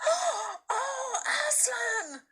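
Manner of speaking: high, frightened-glad gasp of a cry; amazed high cry; astonished cry. A narrator's reading. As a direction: cry out in awe. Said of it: "cried both the children".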